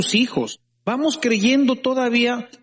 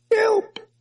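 mock cry for help; 14 semitones higher than usual. Child crying to his father.

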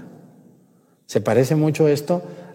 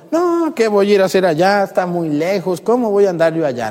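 high, reluctant grumbling objection; whiny complaint; 7 semitones higher, 3 dB louder than usual. Pretend. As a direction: balk at the order, pleading. Imagined prophet complaining to God.

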